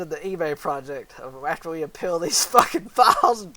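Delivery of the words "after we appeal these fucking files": loud, breaking into laughter; real laughing speech. Real, not acted. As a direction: crack up as you finish the question. Cracking up at AI's leprechaun.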